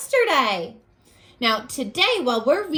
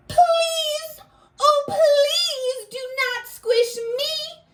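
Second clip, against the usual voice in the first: high, crying plea from a tiny ant; pleading ant; 9 semitones higher, slower than usual. Acted. Teacher reading the ant begging the kid, high crying plea. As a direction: beg for your life, voice breaking high.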